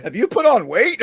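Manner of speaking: high, loud put-on surprise; mock shocked question. Guy at the food pantry asking about weight, mock shock. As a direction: blurt it out like a nosy friend. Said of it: "he said".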